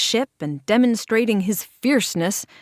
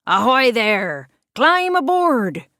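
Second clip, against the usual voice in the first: hollered hail; 3 semitones higher, 5 dB louder than usual. A narrator's reading. Pirate on the last ship hails Small Saul, loud hollered greeting. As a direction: bellow the invitation across the port. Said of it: "a voice hollered".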